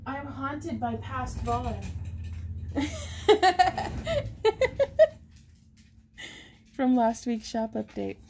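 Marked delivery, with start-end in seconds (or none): 1.9-5.2 high bursting laugh. Real, not acted. Host laughing at her own old recording, bright laugh.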